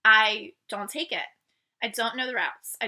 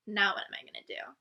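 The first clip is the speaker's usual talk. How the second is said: soft unvoiced whisper, softer and low; whispered despair; 3 semitones lower, 6 dB quieter than usual. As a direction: whisper it to yourself in defeat. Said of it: "I was like".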